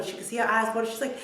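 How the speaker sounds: ordinary delivery, nothing marked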